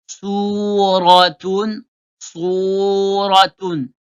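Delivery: "Suratun" drawn out very slowly, syllable by syllable; "suratun" stretched, every sound clear; careful pronunciation. Shown as a demonstration. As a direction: model "suratun" slowly for the class. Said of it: "il faut bien faire la prononciation".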